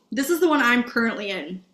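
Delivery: ordinary talk, nothing marked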